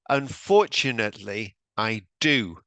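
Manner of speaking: Unfortunately said clearly and firmly, I do landed. Shown as a demonstration. Teacher modelling a clear answer for the class, emphatic. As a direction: make each word of Unfortunately, I do clear. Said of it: "Make sure that this is very clear".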